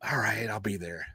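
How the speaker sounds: breathy whispered mock agreement. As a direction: whisper it like a resigned promise. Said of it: "I'm gonna be like".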